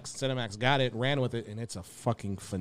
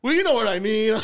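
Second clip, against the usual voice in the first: sheepish cousin, laughing; 9 semitones higher, 9 dB louder than usual. Retold or imitated. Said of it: "He's like".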